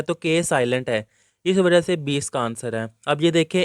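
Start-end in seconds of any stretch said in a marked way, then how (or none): none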